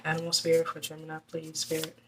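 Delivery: soft, fast murmured plea to the cards; quick hushed invocation, repeated like a chant; asking the spirit. Real, not acted. Reader softly asking the spirit for a card, fast and hushed.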